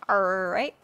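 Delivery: "all right" with a pirate's drawn-out R. Real, not acted. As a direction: lean on the R like a pirate. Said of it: "like a pirate".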